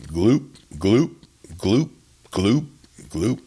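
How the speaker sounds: short rising gloops with gaps; imitated water gloop. A narrator's reading. Narrator imitates the sound heard again, rising gloops. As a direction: mimic a gulping sound from the pond. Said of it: "I heard it again".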